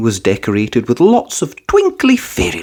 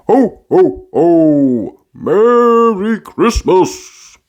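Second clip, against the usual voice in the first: high, slow hammed-up Santa; a jolly Father Christmas greeting; drawn-out festive ho ho ho; Santa's jolly call; 7 semitones higher, 4 dB louder, much slower than usual. A narrator's reading. Santa laugh in story.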